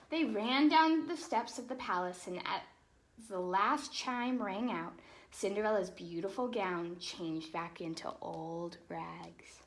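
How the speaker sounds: lively storybook read-aloud; animated picture-book narration; reading to children. Acted. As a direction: read it like bedtime story time for kids.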